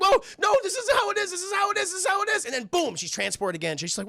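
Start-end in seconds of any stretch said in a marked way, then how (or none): 0.0-2.4 high-pitched frantic denial; mock panic. Girl trying to escape the house, mock panic. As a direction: squeal it out in put-on panic. She frantically denies what she sees.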